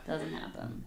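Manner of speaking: usual speaking voice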